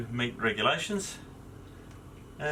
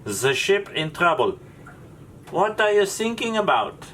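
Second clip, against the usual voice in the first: high-pitched, hammed-up German accent; 9 semitones higher than usual. German coast guard officer answering a sinking ship, high comic German accent. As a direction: deliver the punchline as a high-voiced German officer. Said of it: "that's my German accent".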